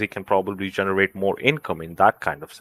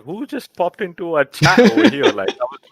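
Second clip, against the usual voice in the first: laughing; 10 semitones higher, 4 dB louder than usual. Retold or imitated. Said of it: "when he brought out the voice".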